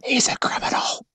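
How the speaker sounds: hushed, scandalized aside; mock whisper. Co-host reacts to handcuff confession, mock whisper. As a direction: whisper it as a pretend secret.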